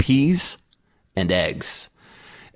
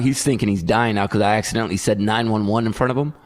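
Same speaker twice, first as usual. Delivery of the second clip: ordinary talk, nothing marked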